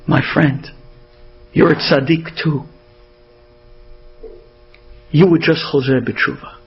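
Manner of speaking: low, halting words with long pauses; a dying man's gentle farewell; last breaths. Retold or imitated. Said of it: "with the last breaths".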